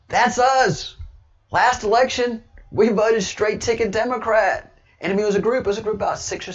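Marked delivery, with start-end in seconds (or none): 0.1-4.7 high, loud excited voicing of the crowd; enthusiastic crowd. Retelling rally supporters to viewers, excited and loud. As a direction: imitate the cheering group shouting its claim. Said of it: "they were enthusiastic".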